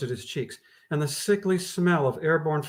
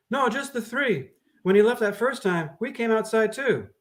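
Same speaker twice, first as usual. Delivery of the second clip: higher-pitched woman's voice; woman witness; 8 semitones higher than usual. Acted. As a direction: answer nervously. Women voiced high.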